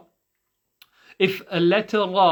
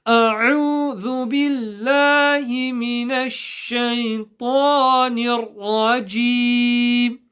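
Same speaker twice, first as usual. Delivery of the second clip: higher Quranic chant, every sound distinct; crystal-clear recitation; 7 semitones higher, 5 dB louder than usual. Shown as a demonstration. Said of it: "Every single sound has to be crystal clear".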